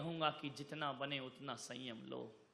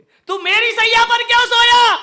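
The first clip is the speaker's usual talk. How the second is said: a high, much louder angry yell; furious king; 22 dB louder than usual. Acted. King scolding the gardener for sleeping on his bed, furious yell. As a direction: blast the question at the servant.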